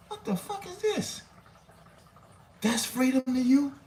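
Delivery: squeaky outraged falsetto; high mock squeal.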